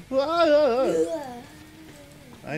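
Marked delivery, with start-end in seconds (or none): 0.1-1.2 high startled yelp whoa; reenacting a jump scare. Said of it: "I was like".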